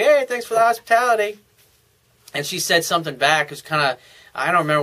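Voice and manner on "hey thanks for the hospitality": higher-pitched, cocky passing remark; smart-ass remark. Retold or imitated. Man walking by tosses the hostess thanks, smart-ass. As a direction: toss it out high, like a smart aleck. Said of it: "make a smart-ass comment".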